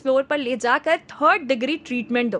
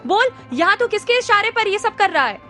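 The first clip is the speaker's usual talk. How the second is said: much higher, louder demand; angry shouted demand; shouting; 8 semitones higher, 4 dB louder than usual. Acted. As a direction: hammer the final question. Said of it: "जोर से चिल्लाया".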